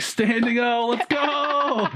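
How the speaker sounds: high cheering crowd yell; excited crowd cheer. Retold or imitated. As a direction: hype up the crowd. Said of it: "everyone was like".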